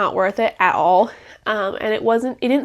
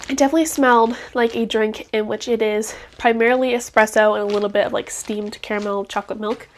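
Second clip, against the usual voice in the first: normal talking voice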